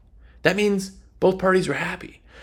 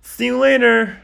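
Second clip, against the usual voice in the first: higher and louder breezy goodbye; breezy brush-off; 7 semitones higher, 6 dB louder than usual. Acted. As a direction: toss it off like you're done with them. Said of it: "and then you just go".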